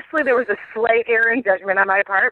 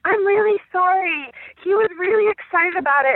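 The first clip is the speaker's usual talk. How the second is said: much higher, sorry nanny voice; apologetic nanny; 11 semitones higher than usual. Retold or imitated. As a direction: squeak out a sheepish apology. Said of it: "The poor nanny is like".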